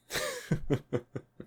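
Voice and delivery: high breathy cackle, far above the speaking voice; demon laugh. Acted. Demon laughing at the listener's question, high cackle. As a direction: let out a sudden high amused laugh.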